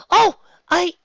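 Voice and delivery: high, loud flustered start. Acted. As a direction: caught off guard, blushing. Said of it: "she said, turning red".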